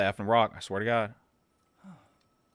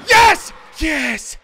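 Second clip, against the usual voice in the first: high, loud cheer; victory shout; 13 dB louder than usual. Real, not acted. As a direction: shout in triumph. Boss beaten.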